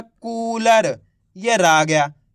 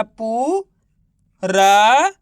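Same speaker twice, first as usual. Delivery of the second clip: stretched, louder रा, pause, then ब; syllable by syllable; 4 dB louder, much slower than usual. Shown as a demonstration. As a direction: sound out रा and ब separately, drawn out. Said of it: "तो मिलके बन गया". (रा, ब को अलग-अलग खींचकर)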